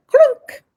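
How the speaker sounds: a sudden high, loud "clunk"; a sharp, squeaky imitated clunk; clunk sound. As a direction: snap it shut like a lock dropping. A conclusion locking shut.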